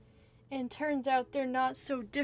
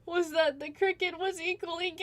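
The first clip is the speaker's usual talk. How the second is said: high, laughing through the words; can't stop giggling while talking; 7 semitones higher than usual. Real, not acted. Giggling while telling love story.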